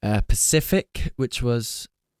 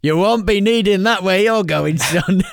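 stern official voice, pitched up and louder; mock guard; 8 semitones higher, 6 dB louder than usual. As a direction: play the gate guard turning him away. Jokey gatekeeper voice to S2.

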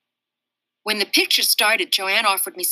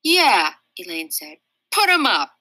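tough challenge; 4 dB louder than usual. Acted. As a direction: dare him to put them up. Elaine challenging Daniel to fight.

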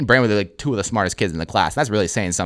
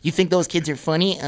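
high, exaggerated mock scolding; mocking teacher imitation; 8 semitones higher than usual. Student mimicking teacher to make friend laugh, mocking. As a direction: parody the teacher in a silly raised voice. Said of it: "[name] mocking her hand gestures".

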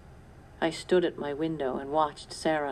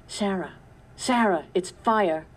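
shouted warning, louder on fire; calling out alarm; 3 semitones higher, 4 dB louder than usual. A narrator's reading. Papa calling Sarah about the fire, alarmed shout. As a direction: call up the stairs in alarm.

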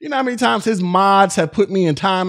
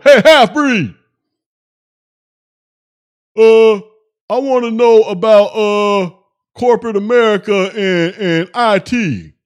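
loud, pitched-up dopey voice with hesitant uhs; mocking rude chatter; 6 dB louder than usual. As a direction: play the clueless troll asking for career advice. Imitating rude chatter's question.

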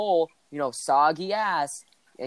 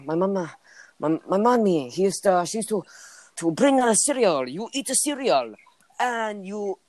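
higher, broken-English imitation of a foreign friend; hammed-up immigrant-kid voice telling his story; friend's foreign accent; 6 semitones higher than usual. Guy imitating his friend from a foreign land telling about cereal, mock accent.

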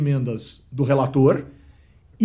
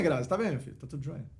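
usual speaking voice

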